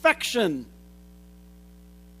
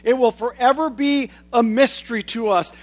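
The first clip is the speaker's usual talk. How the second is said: ordinary talk, nothing marked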